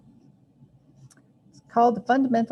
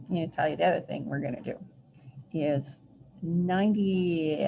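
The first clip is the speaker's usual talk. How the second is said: ordinary delivery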